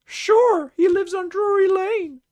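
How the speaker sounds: tiny squeaky cookie voice, very high; Gingerbread Man voice. Retold or imitated. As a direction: pitch way up like a little gingerbread man. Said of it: "gingerbread man impression from Shrek".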